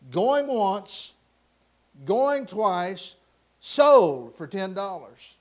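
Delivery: loud count with a big drawn-out finish; auctioneer closing sale. Acted. Auctioneer pounding the gavel, calling the sale loudly. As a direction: call it out and hammer the last one home.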